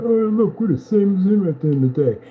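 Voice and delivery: high, loud whiny mock voice; hammed-up taunting imitation; mocking rival. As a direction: sneer it like a copycat bragging.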